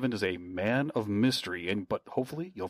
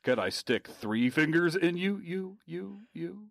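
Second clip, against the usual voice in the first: higher announcer line trailing into echoes; mock echo; 7 semitones higher than usual. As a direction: repeat the last word, fading off. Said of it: "I'm causing that echo".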